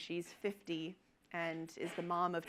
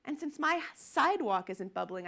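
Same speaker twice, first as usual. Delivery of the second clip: higher, louder, insistent denial; stubborn young woman; 4 semitones higher, 5 dB louder than usual. Retold or imitated. Young woman denying her fire to firefighters, insistent higher voice. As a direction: argue the fire away like it's obvious. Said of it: "And she goes".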